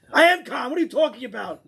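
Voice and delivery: high, loud indignant yell; mock furious shout. Angry man shouting he is calm, high and loud. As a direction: bark it back as if accused.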